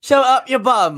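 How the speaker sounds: high mock-English insult; English accent. Echoing the co-host, high-pitched mock-English accent. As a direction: throw it back in hammed-up English. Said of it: "English thing to say".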